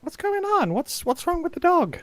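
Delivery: very high, squeaky-pitched questioning; softly panicked high mock voice; mock puzzlement. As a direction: imitate baffled onlookers high up in the voice. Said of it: "It's like".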